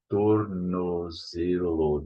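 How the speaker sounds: "tornozelo" stretched out syllable by syllable; tor-no-ze-lo, very slowly; slow careful demo. Shown as a demonstration. Teacher breaking down a hard word, slow careful demo. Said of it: "This is not easy".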